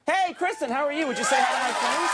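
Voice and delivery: high, bright hello to a star; excited greeting. Real, not acted. Host greeting Kristen Davis for his sailor guests, excited hello. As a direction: call out to her with delight.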